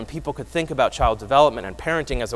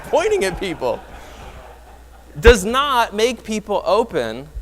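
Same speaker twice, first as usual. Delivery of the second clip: high, loud delivery bubbling with laughter; talking through a laugh, pitched up; amused, laughing; 8 semitones higher, 5 dB louder than usual. Real, not acted. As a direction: fight back real laughter while making the point. Amused by meme, audience laughing.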